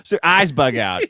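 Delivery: ordinary talk, nothing marked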